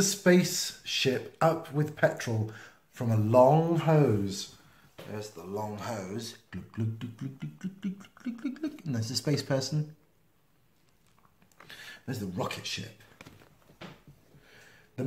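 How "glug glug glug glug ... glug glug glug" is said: glug quietly, rising in pitch as it fills; glugging sound. Acted. Crewman filling the rocket, rising glug noises.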